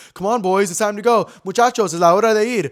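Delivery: mock cheerful guy. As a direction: play the pushy guy rallying the boys. Guy's early morning texts, retold.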